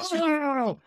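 high, strained comic "ugh"; exaggerated anime hit reaction; mock pain grunt. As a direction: imitate a cartoon fighter taking a hit. Imitating getting beaten in anime.

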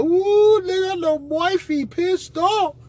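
high, loud whooping hoot; excited hoot. Real, not acted. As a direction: whoop it up, teasing him. Hooting at Snoop's cheating.

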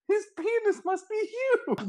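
very high, giggly mock girl voice; retelling a sketch, girl's line in a squeaky giggle. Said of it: "she's like".

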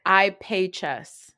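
very slow, word by word; mock read-out. Host quoting a garbled tweet, slowly and deliberately. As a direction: read it out deliberately, each word set apart. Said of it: "And then she says".

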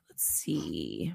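soft unvoiced whisper. Real, not acted. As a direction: breathe it to yourself. Whispered to self.

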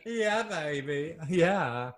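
drawn-out mock-English swinger; Austin Powers. Host joins in quoting the film, playful English accent. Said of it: "Uh, Austin Powers".

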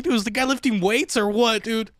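high, loud, baffled question; hammed-up disbelief; incredulous questioning. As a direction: ask the nurse in mock panic. Incredulous questioning of nurse in story.

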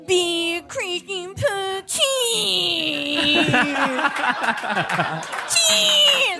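hammed-up slurred shout, last vowel held long; slurred show name. Shown as a demonstration. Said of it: "slurring it".

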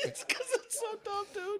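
high, squealing through laughter; helpless laughter. Real, not acted. Comic defending his running joke to his friend, helpless laughter. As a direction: crack up while talking.

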